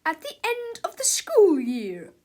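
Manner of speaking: loud, high teacher voice; snappish. A narrator's reading. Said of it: "snapped Miss Ribble".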